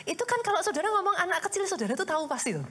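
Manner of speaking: ordinary talk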